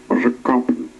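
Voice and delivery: usual speaking voice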